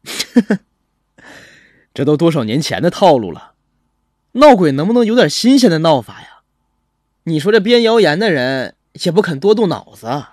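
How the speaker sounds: lightly laughing, lively ribbing; amused teasing. A narrator's reading. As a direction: chuckle first, then mock the stale ghost story. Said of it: "楚小文被我笑得有些悻悻". (笑着调侃)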